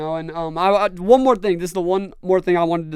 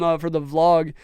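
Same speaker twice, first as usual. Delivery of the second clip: ordinary talk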